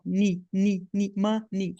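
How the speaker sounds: "ni" repeated as one pure vowel, no diphthong; teacher isolating the sounds of money; syllable drill. Shown as a demonstration. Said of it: "é um som vogal só".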